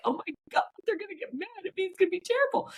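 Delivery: put-on high, fast, childlike panic; mock-frightened little girl voice; scared like a child. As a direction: sound like a ten-year-old in trouble. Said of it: "I was ten".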